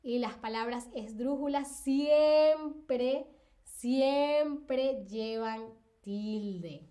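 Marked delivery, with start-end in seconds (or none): 1.8-6.9 siempre repeated, each drawn out slowly; slow emphatic siempre, then measured "llevan tilde". Shown as a demonstration. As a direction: drive the rule home, stretching "siempre". Teacher hammers the rule home.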